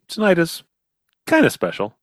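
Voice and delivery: lightly voiced beer commercial. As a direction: echo the beer ad line. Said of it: "Here's to good friends".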